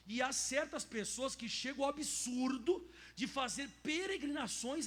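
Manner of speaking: slow, loud sermon reading; preaching read. Acted. Pastor reads text aloud, preaching. (leitura de pregação, lenta e forte)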